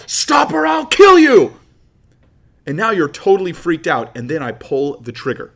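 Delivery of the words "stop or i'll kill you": full-force acted scream, high and drawn out; yelled threat. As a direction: scream the threat slowly at the top of your voice. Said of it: "and I yell".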